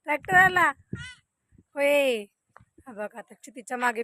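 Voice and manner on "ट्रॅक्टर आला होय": raised, rising call across the field. Real, not acted. Mother calling out to her son in the farm field, loud rising call. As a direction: call his name to get his attention.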